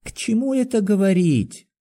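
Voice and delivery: high-pitched, exasperated question; irritated. A narrator's reading. Doctor rejecting friend's reassurance, irritated. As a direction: dismiss the comfort testily. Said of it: "сказал Андрей Ефимович с раздражением".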